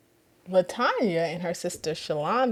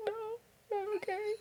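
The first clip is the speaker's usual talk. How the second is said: tearful no; 12 semitones higher, 16 dB quieter, faster than usual. Retold or imitated. Answering through tears.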